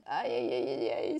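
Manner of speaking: low nervous aïe aïe aïe; worried low moan over the deadline; stressed groaning. Real, not acted. Reading the rematch rules, low stressed groan. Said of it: "c'est le stress".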